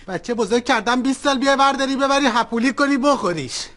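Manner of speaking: ordinary talk, nothing marked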